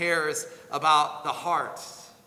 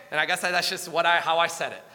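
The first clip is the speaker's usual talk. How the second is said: normal talking voice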